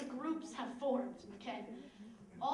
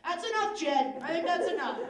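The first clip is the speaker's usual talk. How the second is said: raised-voice dad telling his son off; stern dad; 4 semitones higher, 9 dB louder than usual. Acted. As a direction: call out a firm reprimand, higher and louder.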